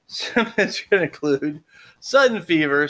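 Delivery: normal talking voice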